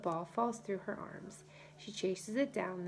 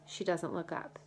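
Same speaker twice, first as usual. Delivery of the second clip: ordinary delivery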